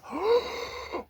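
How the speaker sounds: high, soft intake of breath; gasp. Acted. Host saying Batman needs a breather, acted gasp. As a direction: draw in a long audible breath.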